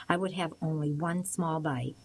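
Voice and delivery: usual speaking voice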